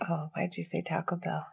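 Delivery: breathy whisper, no voice. Real, not acted. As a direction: whisper it under your breath. Whispered complaint.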